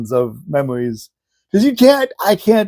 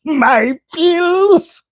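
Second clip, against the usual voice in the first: high, drawn-out mock wail; exasperated grandpa impression, pitched way up; imitated grandpa; 11 semitones higher than usual. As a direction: stretch it out high for deaf ears. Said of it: "That's how he said it to her".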